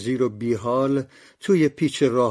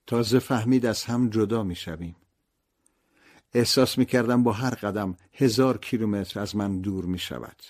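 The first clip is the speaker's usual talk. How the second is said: plain reading voice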